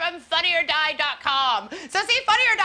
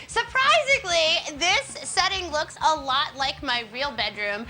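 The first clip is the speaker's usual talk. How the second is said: normal talking voice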